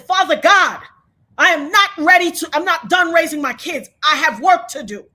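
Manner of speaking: retold impassioned declaration; urgent prayer. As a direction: cry out, refusing to die. Retold cry to God when shot.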